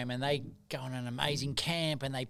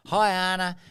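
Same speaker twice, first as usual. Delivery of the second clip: higher, louder, chirpy mum; breezy mum; 5 semitones higher, 4 dB louder than usual. Retold or imitated. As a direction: mum rattling off chores casually. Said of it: "Mum says".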